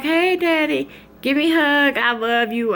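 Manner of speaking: put-on high, sugary daddy's girl voice; butter up dad, high and sweet; imitating a sweet little daughter.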